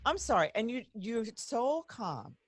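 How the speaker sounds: normal talking voice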